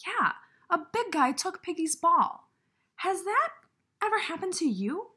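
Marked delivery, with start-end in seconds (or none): none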